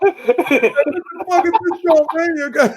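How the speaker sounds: high voice, laughing through it; laughing mock outrage. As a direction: ham up catching her out. Acted confrontation, laughing.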